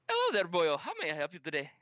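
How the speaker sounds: put-on Irish lilt; Irish accent. Gunsmith greets customer, Irish accent. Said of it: "Fucking Irish".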